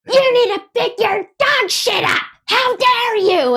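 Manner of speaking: very high, outraged screech; hammed-up shrieking indignation; imitated screaming. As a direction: shriek the scolding, stretching the last words. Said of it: "she starts screaming at me".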